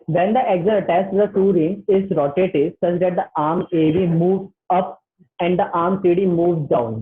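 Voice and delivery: very slow, word by word textbook read. Shown as a demonstration. Teacher reading the textbook slowly for students, slow. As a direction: spell it out slowly for the class. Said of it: "चलो थोड़ा धीरे भी read कर लेते हैं".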